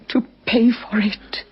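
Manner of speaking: slow, hissed whisper; whispered vow. Acted. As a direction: drop to a bitter whisper. Widow demands killer be punished.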